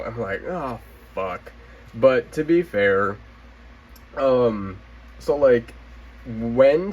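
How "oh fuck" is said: soft, crushed groan of a curse; quiet crestfallen curse; deflated. Retold or imitated. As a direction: let the air out, disappointed. Deflated reaction to no stock.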